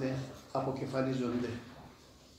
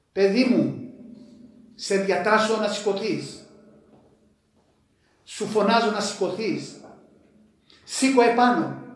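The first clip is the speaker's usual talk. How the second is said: higher and louder, rising command; commanding call; 4 semitones higher, 4 dB louder than usual. Acted. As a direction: order the dead boy to rise. Preacher voices Christ raising the dead.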